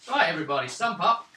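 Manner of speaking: usual speaking voice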